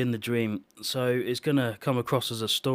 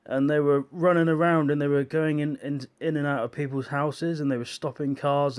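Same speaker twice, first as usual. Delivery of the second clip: ordinary talk, nothing marked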